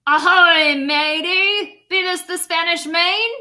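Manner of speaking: booming pirate greeting; pirate yell. Acted. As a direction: bellow it from the boat like a captain. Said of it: "the head pirate climbed out of the boat and yelled".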